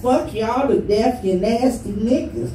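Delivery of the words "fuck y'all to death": usual speaking voice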